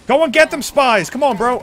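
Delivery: high, loud rally cry; cheering on. Real, not acted. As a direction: urge the spies forward. Cheering teammates on.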